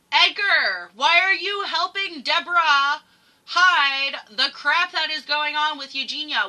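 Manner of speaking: loud, high scolding of someone absent; angry calling out. Acted. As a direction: holler the names, then demand answers. Calls out absent driver, mother angrily.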